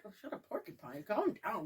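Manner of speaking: low, soft, offhand imitation; unbothered brother. Brother answers his panicked sister about a porcupine, quiet unbothered mimicry. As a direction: drop low and quiet, mimicking someone unfazed.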